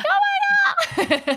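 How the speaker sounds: imitating her own high-pitched excitement; mock excited squeal. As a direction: send up your own girly squeal. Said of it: "My voice just went up".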